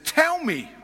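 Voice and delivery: sharp authoritative order; commanding demand. Acted. Jesus demanding an answer.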